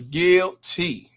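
GUILTY slowed into two separate syllables; drawn-out verdict. Pretend. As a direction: stretch "guilty" out as GUIL, then TEE. Mock verdict read out from chat.

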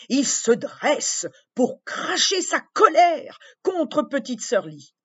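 ordinary delivery

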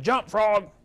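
loud barked order at a frog; a sudden loud shout of command. Retold or imitated. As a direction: call it out like a scientist giving orders. Scientists command the legless frog.

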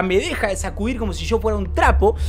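normal talking voice

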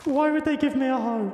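high, soft, squeaky laugh-talk. Real, not acted. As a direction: squeeze the words out through laughter. Laughs at useless item.

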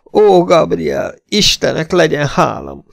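high, drawn-out exclamation; wailing thanks. A narrator's reading. Esmeralda seeing it is Clayton, wailing thanks. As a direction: give thanks in a slow, high wail. Relieved hysterical woman thanks God.

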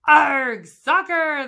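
loud, high group holler; crew yell. A narrator's reading. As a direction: yell it back like the whole crew. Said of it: "the crew yelled".

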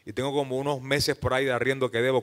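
normal talking voice

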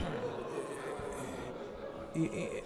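quiet breathy chuckle; a short embarrassed laugh; soft laugh. Real, not acted. Joke about bill number.

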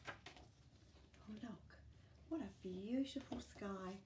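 ordinary delivery

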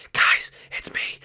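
hushed whisper to the guards. Acted. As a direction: whisper so nobody hears. Sneaking up on guards.

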